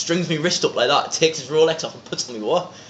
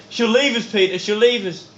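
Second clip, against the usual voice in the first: imitating pleading Leon; 10 semitones higher, 3 dB louder than usual.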